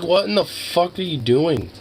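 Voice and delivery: high, slow, drawn-out incredulity; slow disbelief. Retold or imitated. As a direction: stretch out the shock. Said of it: "It's like".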